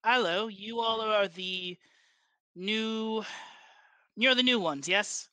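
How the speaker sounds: put-on light Ondarian accent, close to French; heavy Ondarian accent. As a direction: greet the newcomers in a lightly held Ondarian accent. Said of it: "a very heavy Ondarian accent".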